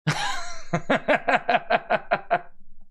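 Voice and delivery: high rapid chuckling laugh; amused laughter. Real, not acted. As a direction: burst into a long, high chuckle. Amused by funny statue picture.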